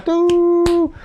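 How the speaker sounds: a high, loud honk; mock car horn. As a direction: play the honking horn. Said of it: "empezó a tocar la bocina". (imitando la bocina del auto)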